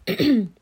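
loud, quick throat clear. Real, not acted. Clearing throat mid-reading.

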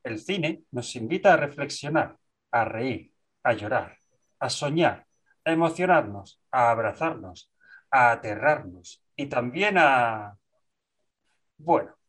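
measured, dramatic podcast opening; formal intro read. Acted. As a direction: read it like a cinema trailer intro.

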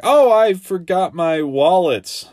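high, slow, loud and oddly sing-song; a strange neighbor inventing an excuse; acting odd. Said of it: "the stroller lady's just acting straight up odd".

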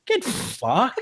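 laughing scoff. Real, not acted. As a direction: scoff through a laugh at the claim. Mocking claim read from article.

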